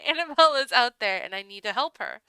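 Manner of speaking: forceful acted outburst; urgent protest. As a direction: insist that someone is in danger. Acted protest to the blocking butler.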